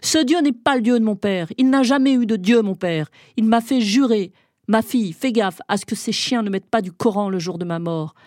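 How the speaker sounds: loud defiant shout; shouting back. Acted. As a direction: yell your father's wishes through the door. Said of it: "je crie à mon tour".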